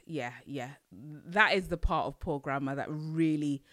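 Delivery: usual speaking voice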